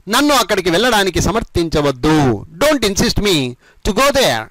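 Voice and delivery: brisker run-through, phrases linked; all joined together. Shown as a demonstration. Teacher repeating the whole sentence for learners, joined up. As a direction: say the whole sentence with few breaks. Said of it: "మొత్తం కలిపి ఈ విధంగా చెప్పండి".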